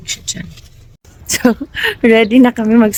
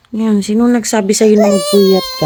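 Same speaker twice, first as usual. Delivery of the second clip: ordinary delivery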